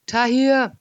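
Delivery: loud high bellow. A narrator's reading. Narrator bellows up to Tahir for help, loud bellow. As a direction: roar the name up the shaft. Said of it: "brüllte ich".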